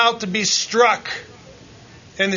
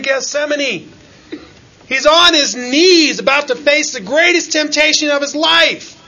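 loud, rising preacher's cry; preaching at full force; 8 semitones higher, 5 dB louder than usual. Acted. Preacher urging the congregation, fervent and loud. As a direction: build the sermon to a fervent peak.

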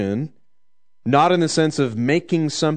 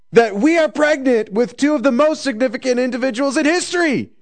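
much higher, excited gushing voice; mock giddy boast; 11 semitones higher, 3 dB louder than usual. Mary gushing to Elizabeth about her pregnancy, excitedly. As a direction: gush like a thrilled friend bragging. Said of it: "aren't sitting there going".

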